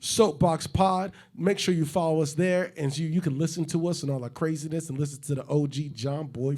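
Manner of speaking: hyped-up ad read, a bit high and loud; promo read. Acted. Host plugging the podcast, upbeat promo voice. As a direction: sell the podcast like a radio spot. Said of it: "hype promo".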